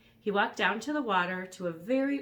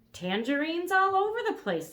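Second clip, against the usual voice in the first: put-on high, loud boy voice; bright little boy; cheerful boy voice; 11 semitones higher, 6 dB louder than usual. Elmer voiced in story.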